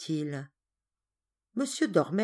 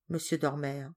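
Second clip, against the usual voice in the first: ordinary delivery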